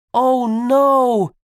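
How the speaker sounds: high, loud cry of alarm; alarmed shout. Acted. Po sees hundreds of cannons, shouting in alarm. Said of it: "shouted Po".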